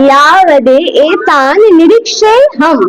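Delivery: ইয়াবদে এ তান নিরিক্ষেই হাম spoken slowly, each word clear; slow recitation. Shown as a demonstration. Teacher leads the class in a slow, careful recitation. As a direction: recite the phrase slowly for students to repeat. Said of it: "আমার সাথে সাথে একটু বলো".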